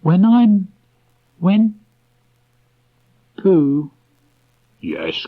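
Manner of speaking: put-on high, halting boyish voice; hesitant boy. A narrator's reading. Christopher Robin trying to tell Pooh, high halting voice. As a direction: stumble over the words like a shy child.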